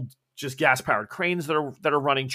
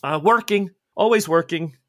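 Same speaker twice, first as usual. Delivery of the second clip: put-on higher boy's voice in broken English; accented shoeshine boy; 3 semitones higher, 4 dB louder than usual. Shoeshine boy explaining his mother always works, accented boyish voice. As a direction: start high and loud, settle toward the end. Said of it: "and then says".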